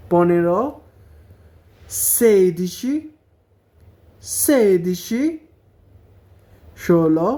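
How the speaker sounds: each number spelled out slowly and clearly for learners; slow careful Italian numbers. Shown as a demonstration. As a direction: say every Italian number twice, carefully, like a teacher drilling. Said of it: "আবার পড়ে দিতেছি, যাতে উচ্চারণগুলো ভালো মতো খেয়াল থাকে".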